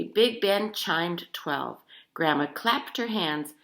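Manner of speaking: usual speaking voice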